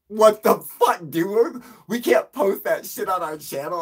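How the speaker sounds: high, loud mock outrage; outraged complaint. Interviewers scold him after the interview, loudly outraged. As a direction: imitate an indignant protest at full voice.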